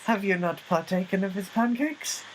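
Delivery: high, archaic mock-formal question; lofty pretend-formal voice. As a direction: ask it like a pompous old courtier.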